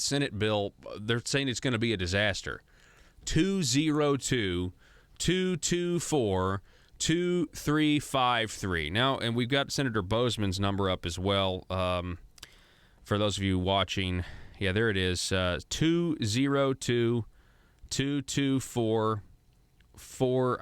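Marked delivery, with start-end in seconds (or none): 3.2-8.9 slow, high, spaced-out digits; slow number read. Shown as a demonstration. Host to viewers, reading the senator's number slowly. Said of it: "I'm gonna say it slower". 15.7-20.6 slow, digit by digit; slow number read. Shown as a demonstration. Host to viewers, reading the phone number slowly. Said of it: "I'm gonna say it slower".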